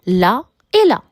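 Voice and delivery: là et là, the two words set apart clearly; contrasting examples. Shown as a demonstration. Teacher showing the homophones to the class.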